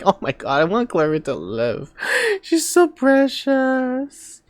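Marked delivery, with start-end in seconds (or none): 2.4-4.4 gushing. Real, not acted. Gushing over a cute character.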